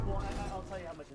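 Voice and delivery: ordinary delivery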